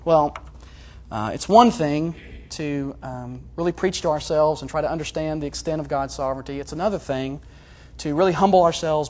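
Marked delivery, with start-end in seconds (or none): none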